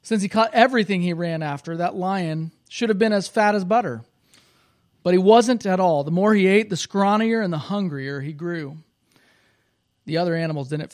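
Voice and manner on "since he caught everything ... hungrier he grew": loud, lively storybook reading; reading to children. Acted. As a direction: read it out big for the kids. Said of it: "in this children's book".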